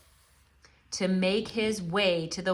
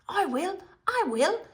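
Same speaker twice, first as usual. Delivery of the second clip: high eager repeats; eager boy; 11 semitones higher than usual. A narrator's reading. Edmund agreeing to the witch, eager and high. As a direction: grab at the offer eagerly. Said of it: "said Edmund".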